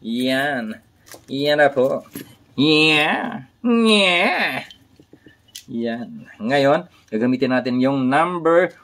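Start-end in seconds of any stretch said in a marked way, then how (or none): 2.5-4.7 high, drawn-out "nyeah"; playful cry. Pretend. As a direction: silly squeal climbing higher on the repeat. Playful cries after showing his work.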